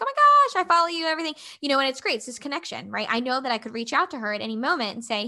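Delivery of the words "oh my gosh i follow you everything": much higher, louder gushing fan; excited fan. Retold or imitated. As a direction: squeal it like a thrilled fan meeting her idol. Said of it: "she's like".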